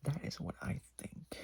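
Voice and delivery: unvoiced conspiratorial whisper. Real, not acted. Whispering her theory to the viewers. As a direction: lean in and whisper it. Whispered repeat of line 144.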